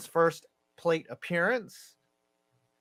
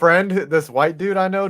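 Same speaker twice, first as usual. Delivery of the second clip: ordinary talk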